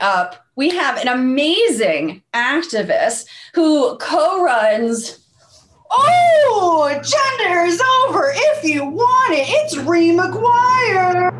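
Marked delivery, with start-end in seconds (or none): 5.9-11.3 high, drawn-out announcer wail; mock-ecstatic high holler; hammed-up guest introduction. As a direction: cry out the name slowly, high and overblown.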